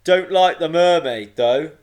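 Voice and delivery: thunderous island creature passing judgement; booming. A narrator's reading. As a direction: boom it out like a huge talking rock. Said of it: "It boomed again".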